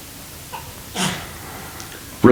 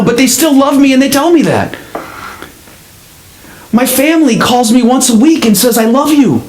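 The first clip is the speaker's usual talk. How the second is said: much higher, rising insistence as she argues back; indignant protest; 9 semitones higher than usual. Acted. Woman on the ledge insisting to the pastor, indignant and much higher. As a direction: push back hard, voice climbing in pitch.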